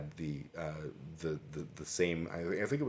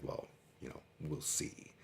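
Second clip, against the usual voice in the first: whispered cold threat; menacing whisper; 3 semitones lower, 9 dB quieter than usual. Retold or imitated. Offended coworker answers the apology in an ominous whisper. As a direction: breathe it out like a veiled warning.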